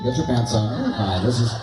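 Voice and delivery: usual speaking voice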